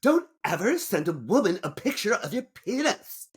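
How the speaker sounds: high, scolding lecture; aggrieved woman. Acted. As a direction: scold him sharply from above. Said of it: "will be playing the aggrieved woman".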